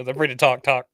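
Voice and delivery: laughing echo imitation; mock echo. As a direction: repeat the last word like a glitch. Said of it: "He goes".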